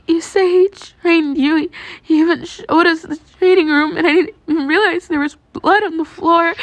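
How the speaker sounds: put-on a girl sobbing out the horror; crying. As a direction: let the tears take over. Girl crying over realization.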